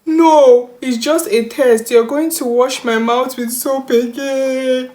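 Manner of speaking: high, loud, hammed-up wail; mock crying harder. Child cries harder at mom's question, hammed-up high wailing. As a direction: start loud and drag it into a fake bawl. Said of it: "I started crying harder and said".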